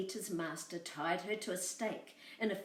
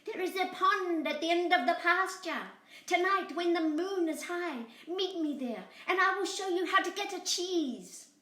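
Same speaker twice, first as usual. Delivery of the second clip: high, louder lamb voice; 10 semitones higher, 5 dB louder than usual. Acted. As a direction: lay out the plan high and sweet. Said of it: "Boragita said".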